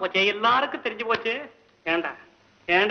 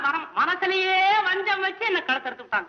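high bitter wail; 7 semitones higher than usual. Acted. Bitter lament about betrayal.

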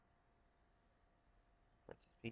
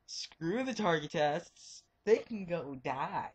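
slow, drawn-out, higher and theatrical; mock-dramatic dismissal; 4 semitones higher, 4 dB louder, slower than usual. Real, not acted. Gamer dismissing the target tests to viewers, slow mock grumble. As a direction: drag it out like a grumpy decree.

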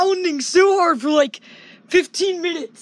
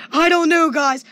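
ordinary talk, nothing marked

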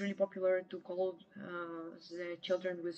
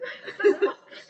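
bursting laugh; 12 semitones higher, 10 dB louder than usual. Real, not acted. Laughs at own joke.

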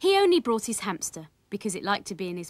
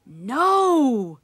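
loud, higher, drawn-out refusal; emphatic storybook outcry; parents crying out; 5 semitones higher, 10 dB louder than usual. A narrator's reading. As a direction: cry it out in long protest. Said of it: "cried Willie's mother and Willie's father".

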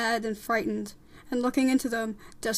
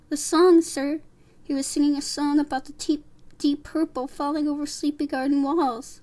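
higher-pitched, eager character voice; small earnest mouse; 4 semitones higher, 5 dB louder than usual. Acted. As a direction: explain it earnestly to the judge. Despereaux explains the song.

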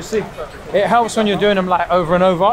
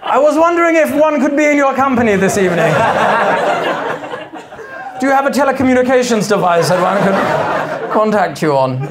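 posh gentleman suitor; 5 semitones higher than usual. Retold or imitated. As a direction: ham up an old-fashioned gentleman calling after a lady. Mock posh suitor in Finchley.

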